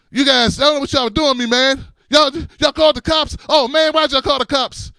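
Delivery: panicked yelling. Retold or imitated. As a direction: yell it like a man who fears arrest.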